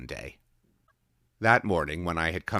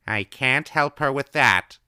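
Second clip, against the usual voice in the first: quietly; 9 semitones higher, 10 dB louder than usual. A narrator's reading. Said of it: "she told me once quietly".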